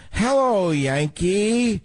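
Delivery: high, sweet mock propaganda voice; Tokyo Rose. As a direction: coo it like a wartime radio siren. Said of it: "We have tapes of her".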